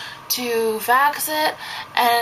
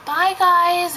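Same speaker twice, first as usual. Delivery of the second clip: bright goodbye; 8 semitones higher, 3 dB louder than usual. Retold or imitated. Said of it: "I was all like".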